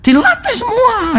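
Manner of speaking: high, drawn-out exhortation; emphatic exhortation. Real, not acted. Preacher urging parents to train every child, high and emphatic. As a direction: pitch it way up and stretch the words.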